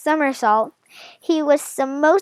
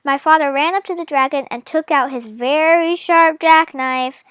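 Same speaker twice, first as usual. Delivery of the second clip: usual speaking voice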